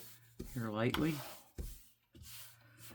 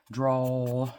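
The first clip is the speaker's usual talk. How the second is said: Southern drawl; 3 semitones higher, 8 dB louder than usual. Shown as a demonstration. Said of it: "Dragging out those words".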